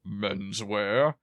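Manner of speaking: Mister Humphreys' put-on deep phone greeting; mock deep voice. Imitating a sitcom salesman answering the store phone, mock deep voice. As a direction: answer slowly, imitating the deepest voice possible. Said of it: "in the deepest voice he could do".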